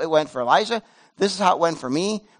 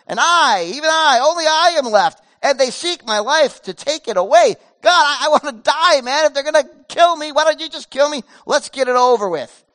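desperate pleading; 6 semitones higher, 5 dB louder than usual. Acted. Elijah's desperate complaint to God.

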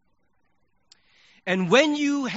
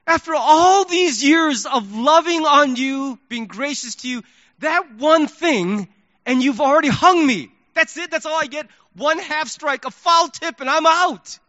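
indignant outburst; 7 semitones higher, 6 dB louder than usual. Retold or imitated. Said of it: "my first thought was".

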